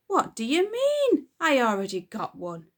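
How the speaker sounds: high, loud disbelieving cry; can't believe what he's hearing; hammed-up outraged dad; incredulous father.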